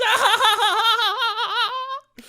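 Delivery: evil laugh. Pretend. Villain laugh after gloat.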